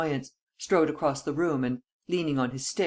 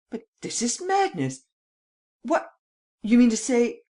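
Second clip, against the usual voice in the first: high, astonished outburst; startled disbelief; 9 semitones higher, 6 dB louder than usual. A narrator's reading. Valenglay hearing someone has entered, startled. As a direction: exclaim in shock, pitch leaping up. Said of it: "Valenglay started".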